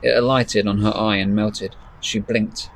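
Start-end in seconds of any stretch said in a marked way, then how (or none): none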